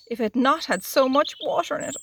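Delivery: usual speaking voice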